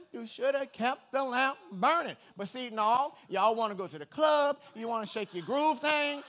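loud fired-up preaching, pushing every line; preaching at full force. Acted. Pastor fires up the church, preaching loud. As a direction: preach it hard to the congregation.

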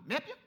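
"Mip you" squashed into one quick word; run-together accent. Retold or imitated. Counter girl greeting a customer, "Mip you" run into one word. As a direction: imitate "Mip you" slurred together for May I help you. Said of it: "She meant it one word".

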